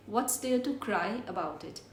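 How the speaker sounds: slow and clear, repeated twice; slow teacher repeat; slow model sentence. Shown as a demonstration. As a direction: drill it slowly twice. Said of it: "हम बोलेंगे".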